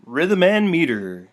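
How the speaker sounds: slow, loud, drawn-out title; mock grand announcement. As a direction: announce it like a big reveal.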